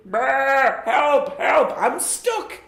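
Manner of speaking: high, bleating cry for help; stuck sheep. Acted. Sheep stuck in a bush, high bleating plea. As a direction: squeak for help like a trapped sheep.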